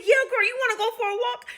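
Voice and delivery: very high, eager invitation; acted upbeat question; encouraging. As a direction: coax her with lifted, cheery pitch.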